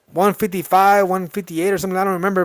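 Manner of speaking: usual speaking voice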